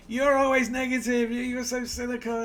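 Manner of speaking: put-on high, loud whiny girlfriend complaint; mock annoyed girlfriend. Girlfriend scolding him after the Cornell visit, mock shrill complaint. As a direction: squeal it like an exasperated girlfriend.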